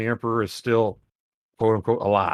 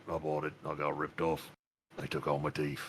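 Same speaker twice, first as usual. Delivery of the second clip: much lower, softer grumble; sulking orc; 8 semitones lower, 14 dB quieter than usual. Pretend. Orc complains he was ripped off, low soft grumble.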